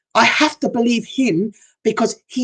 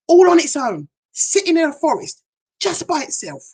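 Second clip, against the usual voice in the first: awed wonder; 9 semitones higher than usual. Real, not acted. Describing the lone turkey to viewers, high and awed. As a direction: pitch jumping high with awe.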